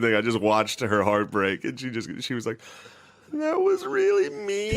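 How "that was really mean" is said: very high, slow imitation of crying; hammed-up tearful whine; mock tearful mom. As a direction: squeal it out like a hurt mom about to cry. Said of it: "and started crying".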